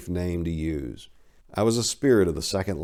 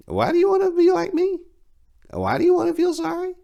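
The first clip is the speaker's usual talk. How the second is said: very high, louder, otherworldly questioning; piercing high voice; 7 dB louder, faster than usual. Acted. Narrator challenges the fallen angel in a very high, loud voice. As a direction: ask it from high up in the head, pressing hard.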